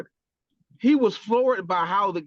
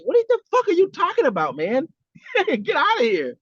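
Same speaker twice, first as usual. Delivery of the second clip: high-pitched, mock-outraged disbelief; incredulous coach; 10 semitones higher than usual. Coach imagined answering a walk-on who wants to play, incredulous. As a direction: scoff as if the player's demand is absurd.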